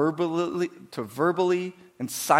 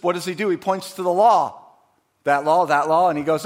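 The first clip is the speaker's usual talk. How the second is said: ordinary talk, nothing marked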